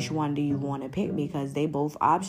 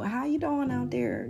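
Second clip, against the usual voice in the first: friendly Southern accent, pitched high; 9 semitones higher than usual. Shown as a demonstration. Host showing her real friendly side, Southern accent. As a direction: lift it into a friendly Southern greeting. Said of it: "my southern accent comes out whenever I'm real friendly".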